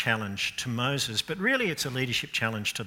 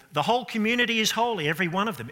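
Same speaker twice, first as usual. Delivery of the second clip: raised, mocking quote of the objector; imitating a complaint; 8 semitones higher, 5 dB louder, faster than usual. Preacher voicing Australians' complaint against God, mocking and raised. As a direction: throw the slogan up high in mock protest.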